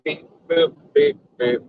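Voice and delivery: beeping robot, rising at the ends; toy robot voice. Pretend. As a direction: play a glitchy little robot. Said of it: "Zen says robot".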